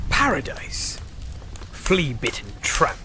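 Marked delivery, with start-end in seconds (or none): 0.1-1.0 whispered, mocking echo; scornful whisper. Tavern keeper mocks the pirate's word, whispered scorn. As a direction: sneer it under your breath.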